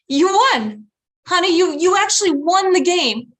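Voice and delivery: high, loud fake cheer; forced enthusiasm. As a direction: sell it with big enthusiasm. Mom improvising praise to crying child.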